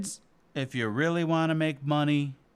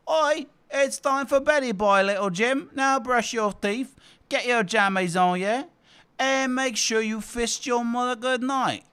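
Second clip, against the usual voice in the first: cockney dad; 6 semitones higher than usual. Acted. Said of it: "your dad says".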